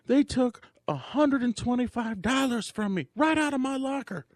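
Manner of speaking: very high, whiny complaint; upset dancer. Retold or imitated. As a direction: pitch it way up, aggrieved. Dancer retold complaining to owner.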